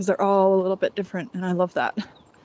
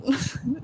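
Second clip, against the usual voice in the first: short laugh; 5 semitones higher, 3 dB quieter than usual. Real, not acted. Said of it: "now I'm gonna laugh".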